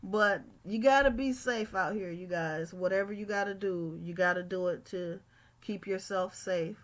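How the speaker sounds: ordinary delivery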